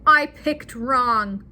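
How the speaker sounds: "I picked wrong." said slowly and flatly, each word weighed; blunt and slow. Retold or imitated. Joan telling her husband Luke she chose wrong, slow and blunt. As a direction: say it slow and cold, no softening. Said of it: "She says".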